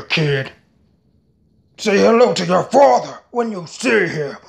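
rapid-fire anime character voices; switching impression voices. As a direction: jump from villain to villain. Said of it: "if I do well, let me know".

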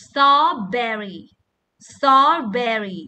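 ordinary talk

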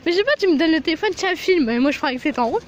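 loud, fast defensive protest. Real, not acted. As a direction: defend yourself, loudly and quickly. (protestation forte)

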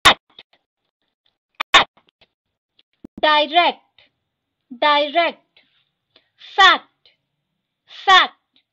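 each word said twice, loud and crisp; teacher drilling vocabulary, clear and loud; word drill reading. Shown as a demonstration. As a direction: read the list aloud for the class to repeat. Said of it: "मैं line by line ऐसे पढ़ते जाऊंगी".

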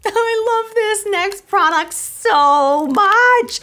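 high, loud and very slow, with a laugh; giddy, laughing delight; laughing gush. Real, not acted. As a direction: gush over it with a giggle. Gushing over favourite product.

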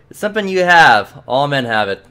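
hint at the price slyly; sly clerk. Acted.

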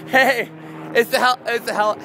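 high, eager stammer; excited. Real, not acted. Excited at helicopter.

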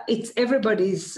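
ordinary talk, nothing marked

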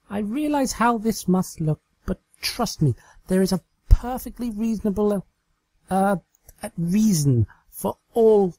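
high, fumbling excuse; Trixie, flustered. Acted. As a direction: talk your way out, embarrassed.

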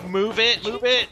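shouted move it; loud urging. Real, not acted. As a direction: yell at him to hurry. Urging partner on in game.

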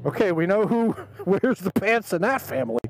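laughing through the words; imitated amused laughter. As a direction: play a father cracking up as he talks. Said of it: "started laughing almost uncontrollably".

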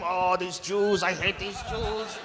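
slow, low, grumbling Hitler on the toilet; mock Hitler grumbling to himself, soft and slow; Hitler muttering. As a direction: mumble it slow and sour.

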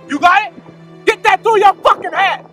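high, loud angry Boston yell; shouting. Acted. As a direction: drill it into his head. Yelling at family.

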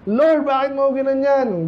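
high, drawn-out imitation of protesting to the Lord; mock complaint to God. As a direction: act out a reproachful "why?" to God. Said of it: "Hindi man lang nagganon". (kunwaring nagrereklamo sa Diyos, mataas at pahaba ang tono)

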